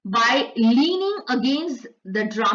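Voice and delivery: slow, careful reading of the English text; slow textbook read-aloud; slow passage reading. Acted. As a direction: read each word out slowly for the class. Slowly reads English passage aloud.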